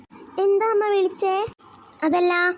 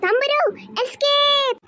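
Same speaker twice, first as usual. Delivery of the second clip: urgent call to flee; 7 semitones higher, slower than usual. Acted. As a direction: warn her sister to run, pitched high. Child warns sister to flee. (ഓടാൻ പറയുന്ന കുട്ടി, ഉയർന്ന സ്വരത്തിൽ പരിഭ്രമം)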